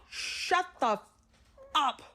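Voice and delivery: punchline advice. Acted. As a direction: deliver the punchline in a sudden high mock-stern voice. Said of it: "the best advice".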